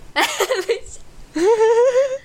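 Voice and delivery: a loud, high shriek of a laugh; embarrassed burst of laughter. Real, not acted. After her friend says to name him, a loud high laugh. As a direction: crack up loudly, caught out.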